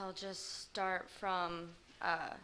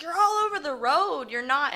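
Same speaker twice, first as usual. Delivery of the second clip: higher and louder retold scream; retold yell; 9 semitones higher, 11 dB louder than usual. Mother screaming at her eight-year-old behind the wheel, loud. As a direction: drunk mom yelling at the child driving, shouting. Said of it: "she starts screaming at me more".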